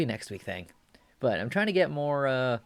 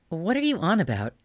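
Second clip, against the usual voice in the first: put-on bratty boy; 6 semitones higher, 3 dB louder than usual. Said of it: "cutie voice".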